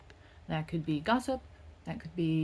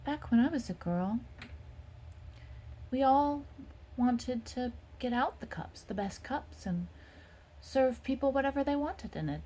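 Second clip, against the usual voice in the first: put-on higher, wistful old lady remembering her girlhood; lightly voiced grandmother reminiscing; nostalgic old woman; 6 semitones higher than usual. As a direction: Rose looking back fondly on the farm. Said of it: "And she's saying".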